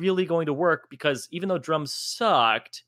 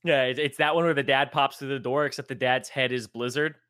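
normal talking voice

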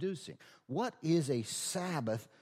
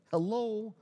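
high mocking hello; sarcastic hello; 9 semitones higher than usual. Real, not acted. Preacher scolding the self-reliant, mocking high hello. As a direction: ring out hello like they missed the obvious. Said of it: "and it's like".

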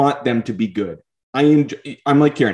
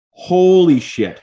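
awed exclamation; 7 semitones higher, 5 dB louder, much slower than usual. Real, not acted. Raving about a pass.

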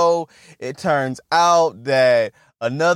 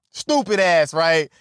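normal talking voice

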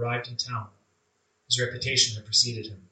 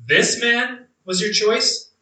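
slow, much higher incredulous question; shocked disbelief; 8 semitones higher, slower than usual. Acted. A disciple questions the Lord's chosen man, slow shocked disbelief. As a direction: ask it as if you can't believe it.

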